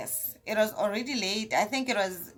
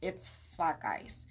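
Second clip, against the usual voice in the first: lower, softer moan about the distance; dropping lament; 5 semitones lower, 13 dB quieter, slower than usual. Real, not acted. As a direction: let it sink, low and soft. Dramatic lament.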